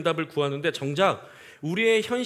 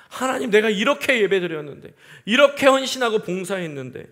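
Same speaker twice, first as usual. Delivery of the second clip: higher, louder aggrieved complaint; complaining to God; 7 semitones higher, 7 dB louder than usual. Retold or imitated. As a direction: protest to God that you deserved better. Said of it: "라고 원망한다는 거예요". (하나님께 높고 크게 원망하는 목소리)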